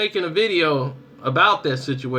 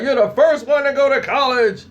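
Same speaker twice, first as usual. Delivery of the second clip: higher, excited parent quote; proud parent mock-exclaiming; 8 semitones higher, 3 dB louder than usual. Parents to their first college-bound kid, excited. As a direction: imitate a thrilled parent cheering.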